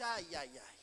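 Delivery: low, soft, weary "ai, ai, ai"; mild groan of dismay. Retold or imitated. Wife hearing her husband's latest idea, low soft dismayed sigh. Joyce dreading husband's strange behaviour.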